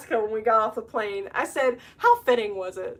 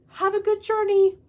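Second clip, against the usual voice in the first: high-pitched imitation of a kindly send-off; sweet lady; 11 semitones higher, 4 dB louder than usual. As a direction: wish a toy rabbit well, high and sweet. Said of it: "she told the rabbit".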